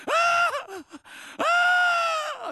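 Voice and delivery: long, high, loud celebratory scream; ecstatic scream. Acted. Freed debtor leaves the master celebrating, ecstatic scream. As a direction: scream at the top of the voice, drawn out. Acts forgiven man celebrating.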